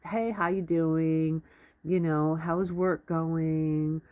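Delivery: slow, drawn-out polite opener; slowed-down small talk. Shown as a demonstration. Advice-giver models a gentler opener for a supervisor, slow and drawn out. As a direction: stretch out each phrase, easing into it. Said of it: "even that's too fast".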